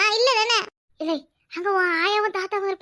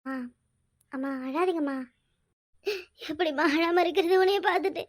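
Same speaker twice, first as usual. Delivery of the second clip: crying softly; 4 semitones lower, 6 dB quieter than usual. Acted. Emotional reunion, both weeping.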